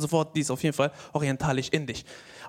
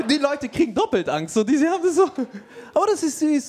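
laughing; 8 semitones higher, 4 dB louder than usual. Real, not acted. Laughing at own joke.

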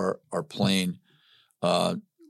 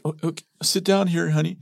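lightly imitated wife, pitched higher; concerned wife; 8 semitones higher than usual. Wife sitting her tearful husband down, lightly voiced concern. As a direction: humor him, raised voice.

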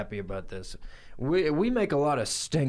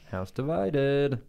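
slow dramatic; 3 semitones higher, much slower than usual. Pretend. Slow dramatic tag line.

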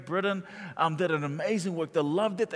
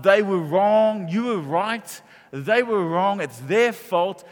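mock self-righteous; 4 semitones higher, 7 dB louder, much slower than usual. Mocking a grudge-holder's logic.

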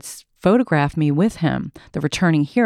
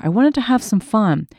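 ordinary delivery, nothing marked

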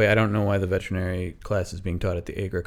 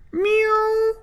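very high cat cry; squeaky imitation meow; whiny cat meow; 3 dB louder than usual. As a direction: mew like a demanding cat. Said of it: "Salem is like".

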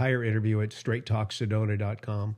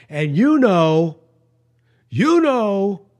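loud, slow, stretched-out hesitation; mock caller loudly stalling before the punchline; drawn-out hedging; 8 semitones higher, 11 dB louder, much slower than usual. Caller hedging before antisemitic claim.

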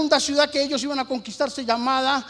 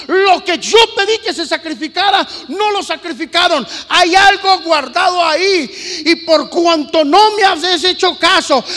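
loud, higher shouted divine rebuke; God's anger; 7 semitones higher, 13 dB louder than usual. Acted. God rebuking Josué over the hidden curse, loud angry shout. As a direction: thunder out the curse.

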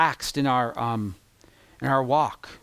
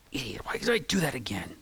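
whispered self-reproach; muttered under the breath at himself; self-scolding whisper; 6 dB quieter than usual. Retold or imitated. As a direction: hiss it at yourself in frustration. Kicking himself over his own mistake.

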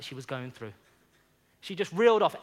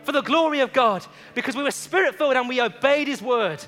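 louder, rousing sermon delivery; preaching at full force; 4 semitones higher, 6 dB louder than usual. Acted. Pastor rallying the congregation to evangelize, rousing preaching. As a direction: stir the church to go and witness.

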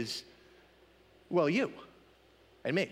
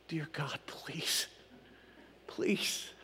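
hushed, pleading whisper; whispered mock prayer; 6 semitones lower, 10 dB quieter, much slower than usual. Lottery ticket buyer secretly begging God, hushed whispered prayer. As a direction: drop to a whisper and beg.

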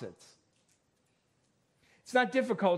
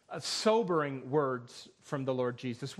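normal talking voice

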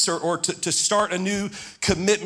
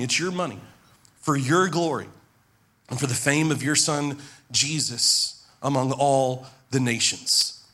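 prayer; 5 semitones lower than usual. Acted. Pastor leads closing prayer.

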